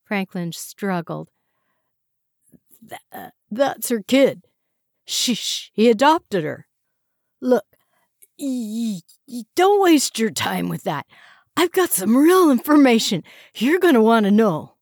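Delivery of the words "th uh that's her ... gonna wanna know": high, loud, stammering panic; panicked stammer. A narrator's reading. Prisoner desperately deflecting questions, loud, high and stammering. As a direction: stumble over words, desperate.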